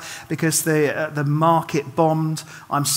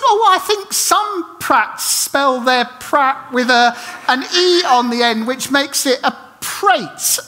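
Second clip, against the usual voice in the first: flustered, awkward explaining; 6 semitones higher, slower than usual. Retold or imitated. Awkward attempt to smooth things.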